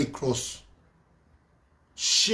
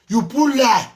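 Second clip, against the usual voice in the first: angry; 7 semitones higher, 8 dB louder, slower than usual. Real, not acted. Angry rant at Atiti.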